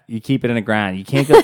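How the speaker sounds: thick local Pittsburgh vowels; Pittsburgh accent. Shown as a demonstration. Teasing partner who hates it, Pittsburgh accent. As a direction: rattle on in Pittsburghese. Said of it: "than that accent".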